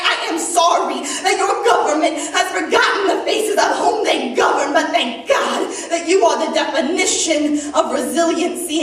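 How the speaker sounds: heartfelt poetry recital to an audience; slam poem. Acted. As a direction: perform it like a slam poet, swelling with conviction then easing at the close.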